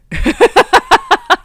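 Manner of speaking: high, loud rapid giggle; giddy laugh. Real, not acted. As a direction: burst into quick high giggles. Still giddy over the news.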